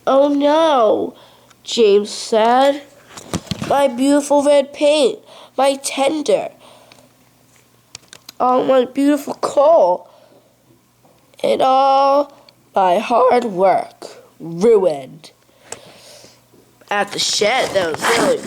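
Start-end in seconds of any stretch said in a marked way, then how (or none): 0.1-1.1 high, loud wail of dismay; upset, raised voice; dismayed cry. Acted. James laments his crash. 3.7-15.3 high, loud lament over the wreck; hammed-up dismay; distraught lament. James laments his crash.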